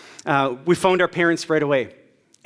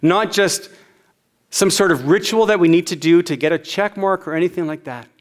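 ordinary delivery

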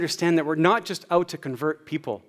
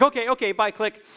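okay okay bye, pitched up high and hurried; a mock flustered rush off the phone; flustered hang-up; 6 semitones higher than usual. Fiancée cutting her dad off, mock flustered and high.

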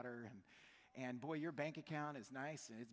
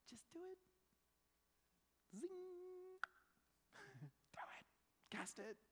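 playful urging; 6 dB quieter than usual. Retold or imitated. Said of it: "And he says".

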